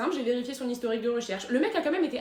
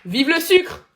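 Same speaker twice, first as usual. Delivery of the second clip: very loud, higher rallying shout; cri de ralliement; 4 semitones higher, 12 dB louder, much slower than usual. Acted. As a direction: yell it like a slogan. Shouted sketch slogan. (cri fort et enjoué)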